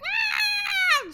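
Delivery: high, loud cat yowl; cat shout. Retold or imitated. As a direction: imitate an angry old cat shouting. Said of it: "she would shout at him and be like".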